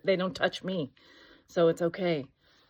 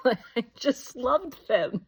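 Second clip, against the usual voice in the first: fond; 6 semitones higher, 3 dB louder, much slower than usual. Real, not acted. Remembering her favorite kids.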